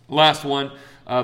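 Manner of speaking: ordinary talk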